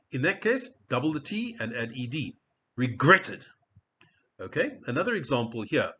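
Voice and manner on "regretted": REGRETTED with a louder GRET; second-syllable stress. Shown as a demonstration. Teacher gives the past form, REGRETTED punched on GRET. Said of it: "stress is on the second syllable".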